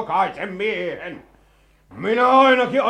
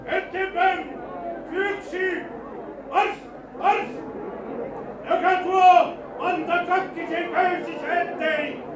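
high, drawn-out battle roar; 10 semitones higher than usual. Acted. Colonel leading the rebel charge with promises of promotion, roaring. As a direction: bellow marching orders over the fighting. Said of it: "Tuo karjunta".

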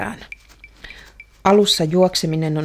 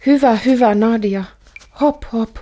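higher, louder encouraging cheer; cheering father; 5 semitones higher, 4 dB louder than usual. Pretend. Child imagines father cheering her on, excited. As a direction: egg the runner on like a coach. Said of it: "jos sinä sanoisit".

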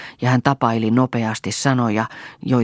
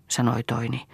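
plain reading voice

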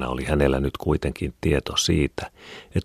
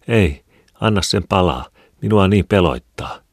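higher, louder anxious pleading; frightened plea; 5 semitones higher, 5 dB louder than usual. A narrator's reading. Wife begging husband to keep the lamp burning: anxious plea. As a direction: beg him not to put out the light.

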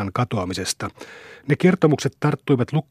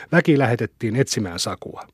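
ordinary delivery

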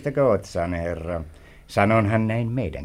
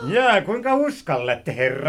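mocking echo; 6 semitones higher than usual.

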